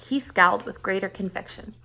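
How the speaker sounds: plain reading voice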